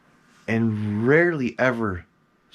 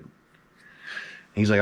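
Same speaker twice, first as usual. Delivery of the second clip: ordinary delivery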